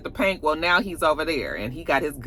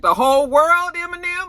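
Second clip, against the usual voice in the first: high, disbelieving squeal; can't believe what he just said; high mock disbelief; incredulous; 14 semitones higher, 7 dB louder than usual. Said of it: "I was just like".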